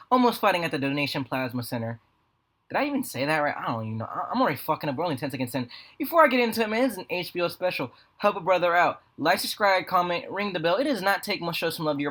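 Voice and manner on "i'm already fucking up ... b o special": normal talking voice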